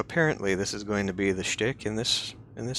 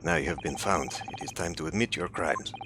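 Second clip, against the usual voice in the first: put-on Russian accent, accusing; 4 semitones lower than usual. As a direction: play a Russian captor with a thick accent. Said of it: "possibly a Russian accent".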